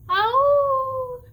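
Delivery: loud, high, long howl; wolf-like howl. Shown as a demonstration. Leader shows children howling with a friend, loud howl. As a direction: howl out high and let it sink slightly. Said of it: "You can howl with a friend".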